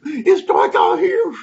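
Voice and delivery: loud, high, drawn-out complaint; distressed cry. Acted. Animals huddled round a tree at night, loud drawn-out cry. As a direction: wail it out slowly.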